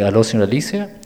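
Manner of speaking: low, put-on prank-call voice; disguised phone voice. Son prank-calling his mother, disguised low voice. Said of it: "le cambié la voz".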